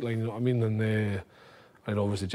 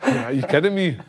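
louder, higher, half-laughing; laughing disbelief; 5 semitones higher, 5 dB louder than usual. Retold or imitated. Teammate laughing at the team talk, mock disbelief. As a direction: squeal it through a laugh.

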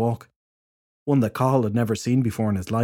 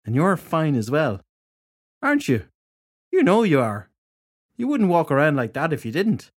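tipsy flirting; 5 semitones higher than usual. A narrator's reading. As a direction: flirt with him, tipsy, pitch sliding up.

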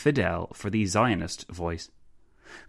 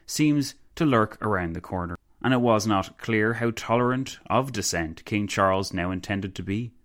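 plain reading voice

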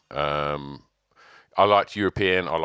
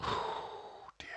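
whispered oh dear; 14 dB quieter than usual. Real, not acted. Sigh before choosing.

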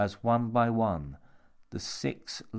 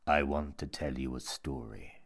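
low, intimate menace; sinister monk; 7 semitones lower than usual. A narrator's reading. Monk close at Jimmy's ear, low and sinister. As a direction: lean in and offer it ominously low.

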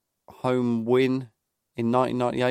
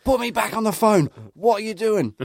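furious wife; 10 semitones higher than usual. Retold or imitated. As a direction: imitate the wife losing her temper on the phone. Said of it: "their mum at that point goes ballistic".

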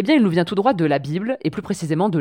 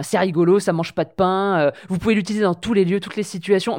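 normal talking voice

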